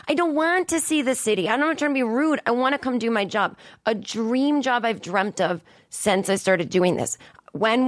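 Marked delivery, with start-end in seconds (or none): 0.1-3.5 high, insistent protest; exasperated. Real, not acted. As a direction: push back, fed up. Ranting about press questions.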